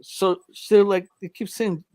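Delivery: usual speaking voice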